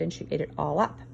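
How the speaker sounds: usual speaking voice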